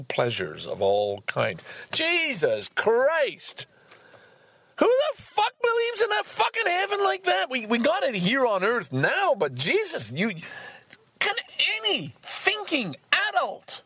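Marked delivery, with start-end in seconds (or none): 1.9-9.3 incredulous outburst. Real, not acted. Reading about heaven as a playground, incredulous high outburst. Indignant reaction to book passage. 9.3-13.8 much higher, exasperated plea; incredulous. Real, not acted. Scoffing at believers, high exasperated question. Indignant reaction continues.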